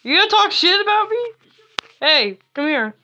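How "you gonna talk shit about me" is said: mock challenge. Confronting player, mock aggression.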